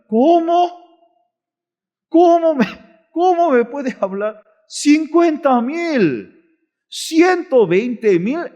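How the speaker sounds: high, incredulous outburst; indignant. Acted. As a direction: stretch the figures out in high-pitched outrage. Owner outraged at the low offer.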